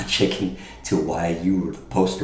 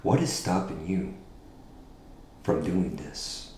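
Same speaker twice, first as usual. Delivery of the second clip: quiet menacing promo; 4 semitones lower, 13 dB quieter than usual. Acted. Hushed taunt in promo.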